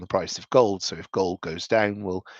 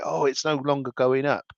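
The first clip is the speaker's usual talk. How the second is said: higher-pitched imitation of upset investors; mock emotional complaint; 6 semitones higher than usual. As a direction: parody their fretting, lightly. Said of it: "people get very emotional".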